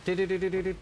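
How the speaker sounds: rapid mock babble of xQc's fast talk; sped-up imitation gibberish. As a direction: rattle off nonsense syllables like a fast-talking streamer. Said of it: "do you all sound like X Q C".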